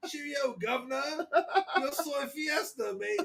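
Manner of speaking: high, slow cockney-style British put-on; hammy British accent, giggling through it; British-accented Spanish. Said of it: "talk in Spanish with a British accent".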